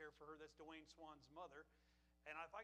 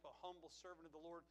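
ordinary talk, nothing marked

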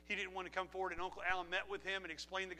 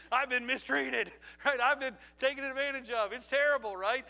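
louder, higher laughing complaint; chuckling mock self-pity; 5 semitones higher, 8 dB louder than usual. Real, not acted. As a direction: laugh through your own hard-luck story. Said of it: "I'm serious".

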